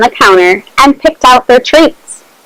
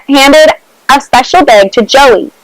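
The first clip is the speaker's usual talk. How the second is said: plain reading voice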